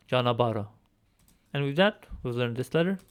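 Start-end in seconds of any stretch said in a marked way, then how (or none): 0.1-0.7 janabara with the article, no h sound; janabara, falling tune; Armenian pronunciation. Shown as a demonstration. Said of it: "with the definite article".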